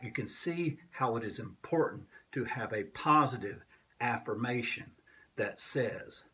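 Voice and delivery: usual speaking voice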